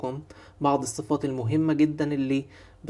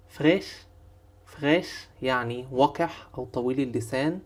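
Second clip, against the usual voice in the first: ordinary delivery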